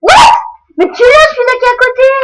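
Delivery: loud, high yell of surprise; yelling in disbelief; shocked shout. Real, not acted. Sudden gaming shock.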